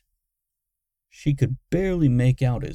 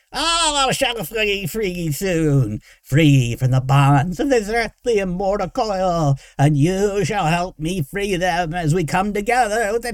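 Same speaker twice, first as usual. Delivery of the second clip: high, loud raving creature voice; frenzied; 9 semitones higher, 6 dB louder than usual. A narrator's reading. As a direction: rave ecstatically, building higher. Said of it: "Derek was in a frenzy now".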